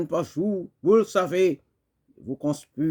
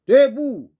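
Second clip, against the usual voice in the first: high, loud one-word command; rallying shout; 10 semitones higher, 8 dB louder than usual. Acted. Poet calling comrade earth to rise, shouted. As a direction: burst out as if rousing a crowd.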